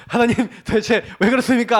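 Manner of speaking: loud, grumbling plea to God; whining protest at God; grumbling complaint. Retold or imitated. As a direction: complain out loud like a sulking child. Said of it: "하고 투덜투덜 해봤거든요".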